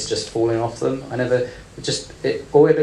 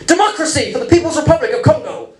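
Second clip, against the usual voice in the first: mock proclamation; 5 dB louder than usual. Absurd reply to break small talk.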